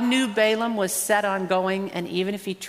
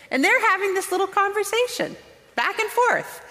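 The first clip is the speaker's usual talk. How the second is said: much higher, marvelling; incredulous, pitched way up; amazed; 12 semitones higher than usual. Real, not acted. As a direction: sound astonished at the story. Marvelling at talking donkey.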